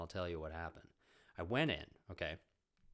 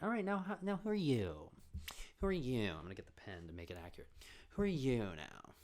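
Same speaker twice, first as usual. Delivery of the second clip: pretentious acting teacher; 7 semitones higher than usual. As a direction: draw it out like a grand Hollywood guru. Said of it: "this really intense, pretentious Hollywood, uh, teacher".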